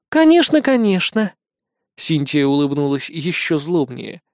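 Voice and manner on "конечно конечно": high, over-eager assent; eager agreement. A narrator's reading. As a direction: agree quickly and sweetly, pitched way up. Goddess feigns agreement to friend. (поспешно соглашаясь, высоким голосом)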